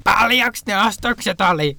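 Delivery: high, drawn-out shouted question; mock shouting. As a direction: call it out like a customer who can only shout. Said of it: "vaan siis huutoa legit". (leikillään huutaen kysytty)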